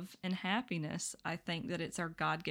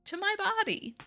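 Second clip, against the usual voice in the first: stunned surprise; 7 semitones higher, 5 dB louder, slower than usual. Retold or imitated. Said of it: "And I'm like".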